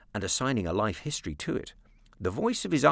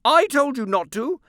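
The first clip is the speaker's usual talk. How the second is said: high, loud impatient aunt; 9 dB louder than usual. A narrator's reading. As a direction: snap it up out of the tank. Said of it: "rather impatiently".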